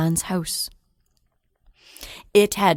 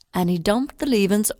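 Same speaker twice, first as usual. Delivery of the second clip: usual speaking voice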